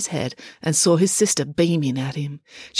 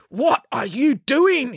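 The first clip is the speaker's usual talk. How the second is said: slow, raised-pitch demand; 6 semitones higher, slower than usual. A narrator's reading. Coach Voss to the children hanging there, demanding. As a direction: demand an answer, word by word. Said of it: "he demanded".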